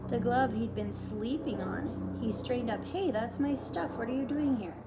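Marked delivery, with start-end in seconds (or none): 2.9-4.8 put-on raised, higher boy's protest; boy demanding his stuff back, startled and loud. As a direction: call out at the stranger in alarm. Boy startled, woman in his barn.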